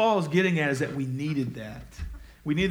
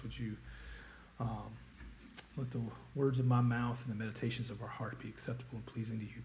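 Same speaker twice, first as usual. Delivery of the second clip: much softer, lower, reverent prayer voice; 4 semitones lower, 9 dB quieter than usual. Real, not acted. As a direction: pray softly and humbly before the sermon. Pastor leads opening prayer.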